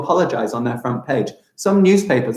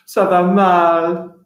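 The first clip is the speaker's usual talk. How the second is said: mock weary ça va mal; 4 semitones higher, 3 dB louder, much slower than usual. Shown as a demonstration. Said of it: "And you reply to them".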